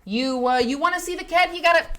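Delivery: high, loud hammed-up hustler voice; mock greedy demand. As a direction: playfully shake down the viewers. Teasing chat about the cat.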